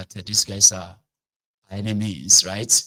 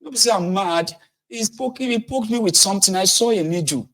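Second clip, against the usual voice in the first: high, pleading protest; high frantic pleading; desperate denial; 14 semitones higher than usual. Retold or imitated. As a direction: insist you are not mad. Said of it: "He said".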